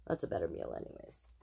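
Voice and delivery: whispered, fast aside. Real, not acted. Reader adds a joke to listeners, whispered. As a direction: lean in and whisper it.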